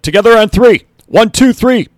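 high, loud rallying count. A narrator's reading. Coach leading the team huddle, loud high count. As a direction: hype the huddle, call it out loud. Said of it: "Dell said".

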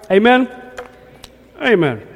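high, loud rising call; call for amen. Real, not acted. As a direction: rouse the room to answer. Calling for response.

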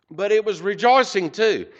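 fighting back tears, then lean on it; emotional. Real, not acted. Preacher moved while telling the church of the man's tears, emotional. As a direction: voice rising and swelling with real feeling, slowing on "But he just broke down and cried". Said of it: "it's a l-, little emotional".

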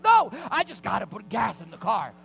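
loud, high insistent shout; freaking out. Retold or imitated. Husband insisting to wife on getting gas, freaking out. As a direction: shout your insistence over any objection. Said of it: "I lost it".

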